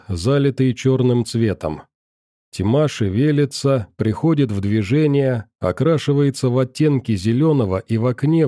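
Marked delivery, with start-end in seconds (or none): none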